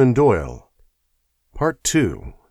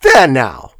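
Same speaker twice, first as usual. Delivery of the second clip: louder, defiant exclamation; triumphant cry; 3 semitones higher, 9 dB louder than usual. Acted. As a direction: throw it at Lestrade in triumph. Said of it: "she cried".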